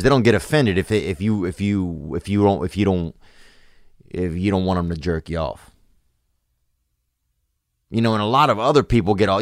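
ordinary talk, nothing marked

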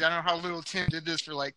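normal talking voice

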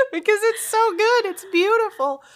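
high voice, laughing through tears; tearful with delight. Real, not acted. Co-host defends crying over a good pun, tearful delight. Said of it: "bring tears to my eyes".

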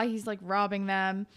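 ordinary talk

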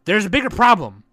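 loud, high heated rant; ranting yell. Real, not acted. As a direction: jab the point home loudly. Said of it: "I didn't mean to yell".

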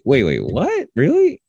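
high-pitched disbelief, leaping up on "Really"; incredulous, voice shooting up; taken aback. Retold or imitated. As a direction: act out a stunned double-take. Host reacts to guest's dismissal.

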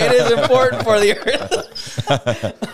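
slow, high, laughing, halting; sounding out words. Shown as a demonstration. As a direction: drag it out while laughing. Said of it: "Sound out the words".

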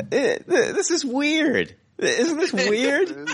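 high, slow and laughing; laughing disbelief. Real, not acted. Laughing at surprising news.

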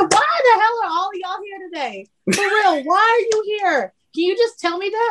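retold high-pitched exasperated demand; lashing out. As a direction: reenact snapping at unwelcome visitors. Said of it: "that's why I lashed out".

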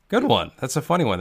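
high, bright mock-amused compliment; mock cheerful praise. Onlooker praising a prank at the pie place, mock cheerful. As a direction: gush it like a polite fan.

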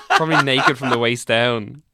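real laugh running into the words; amused, giggling jibe; laughing tease. Real, not acted. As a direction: tease through your laughter. Laughing while teasing co-host.

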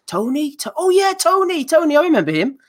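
a lightly mocked star who barely remembers him; vague Joshua. Joshua barely recalls Bellew, high and puzzled. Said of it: "Joshua's a bit like".

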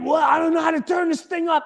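high-pitched, hurried mock panic; panicked shout. Acting himself fumbling with the alarm, panicked. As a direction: flail aloud, voice way up high.

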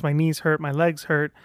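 ordinary delivery

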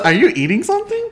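high, laughing surprised question. Retold or imitated. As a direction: ask in amused disbelief.

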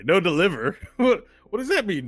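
loud, high mocking question with a laugh; acted scornful amusement; incredulous laughing. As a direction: laugh in disbelief as you ask. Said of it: "Brent gets up and he goes".

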